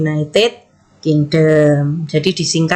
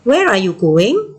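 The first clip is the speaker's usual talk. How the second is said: slow question rising on "going"; intonasi bertanya; slower than usual. Shown as a demonstration. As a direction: model "Where are you going?" slowly, lifting the end. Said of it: "Kan bertanya". (intonasi bertanya pelan, nada naik di "going")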